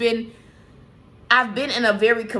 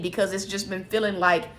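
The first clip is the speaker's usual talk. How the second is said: ordinary talk, nothing marked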